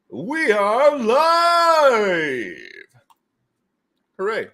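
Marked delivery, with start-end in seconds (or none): 0.1-2.6 announcer voice. Acted. Stretched announcer call.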